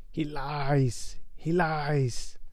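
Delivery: lightly voiced high eerie voice; a thin, mildly high hiss of a voice. Voldemort's face warning Quirrell in a mildly high voice. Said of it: "a high voice spoke".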